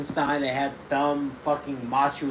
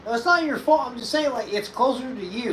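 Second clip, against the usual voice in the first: defensive explaining; 8 semitones higher, 4 dB louder than usual. Real, not acted. Squabble over who fetches it.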